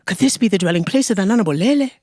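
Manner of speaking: ordinary delivery, nothing marked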